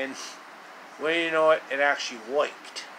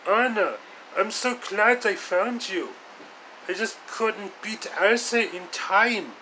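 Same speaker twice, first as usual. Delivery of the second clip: higher put-on hero voice, rescuer apologizing; hero Yoshi voice; 7 semitones higher than usual. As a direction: play the earnest rescuer who came too late.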